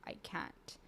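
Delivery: breathless, broken whisper; desperate whisper. Retold or imitated. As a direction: whisper it like you're falling apart. Breaking down, telling husband.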